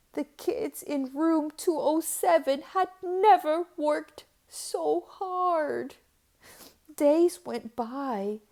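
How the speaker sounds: ordinary delivery, nothing marked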